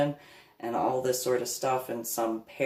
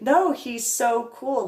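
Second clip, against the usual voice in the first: high, slow gushing mock voice; mocking Pam; 11 semitones higher, 5 dB louder, slower than usual. Imitating Pam gushing over the photo, high mock gush. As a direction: gush like a starstruck fan. Mimicking Pam's admiration.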